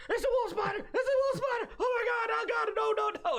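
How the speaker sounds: very high, frantic mock shriek; panicked screaming. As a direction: scream a warning in hammed-up terror. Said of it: "I'm just screaming into the mic".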